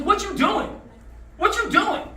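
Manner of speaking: high-pitched turtle demand; mock indignant questioning. Turtle confronts the stinging scorpion.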